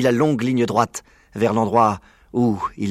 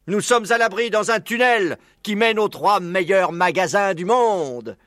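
high, animated oratory; persuasive speechmaking fox; 8 semitones higher than usual. Acted. Maître Renard laying out his plan to the animals, orating. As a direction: win over the whole assembly.

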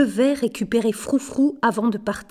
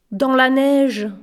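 higher, louder, carefully articulated reply; articulé avec soin; 4 semitones higher, 5 dB louder, slower than usual. A narrator's reading. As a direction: articulate each word distinctly. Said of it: "articula Minuit cinq". (articulé, plus haut et plus fort)

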